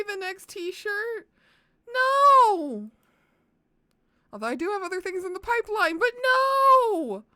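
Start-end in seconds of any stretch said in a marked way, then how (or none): 1.9-2.9 sharp high shouted no; loud no. Real, not acted. Refusing t-shirt idea. 6.0-7.2 high, loud firm no. Real, not acted. Refusing again.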